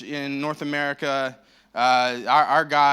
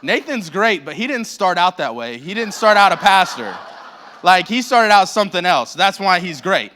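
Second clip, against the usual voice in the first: laughing; 2 semitones higher, 8 dB louder than usual. Real, not acted. Laughing while teasing a pastor.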